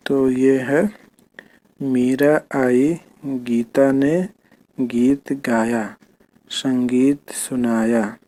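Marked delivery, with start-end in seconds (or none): 1.8-8.2 very slow, word by word; teacher reading the sentence slowly to the class. Shown as a demonstration. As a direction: read each word slowly for children to follow. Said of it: "ठीक है, तो ऐसे पढ़ना है".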